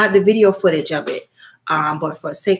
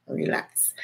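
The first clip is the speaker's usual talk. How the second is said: a breathy whispered self-reminder to relax; 11 dB quieter than usual. Retold or imitated. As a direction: whisper it to yourself. Calming herself after elevator.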